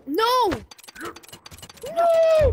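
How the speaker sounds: high, drawn-out gamer howl; dismayed yell. Real, not acted. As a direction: wail it as the game slips away. Dismay as game goes wrong.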